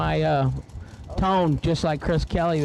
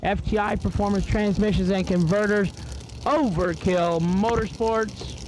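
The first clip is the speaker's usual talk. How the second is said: race announcer; 5 semitones higher than usual. Acted. Announcer to the grandstands, calling each run's numbers in an announcer read. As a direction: drag strip PA announcer calling times.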